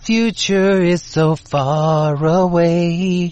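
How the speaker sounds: very slow, drawn-out spectral voice; ghostly Christmas voice. Pretend. Doing a Christmas ghost for the co-host: slow eerie moan.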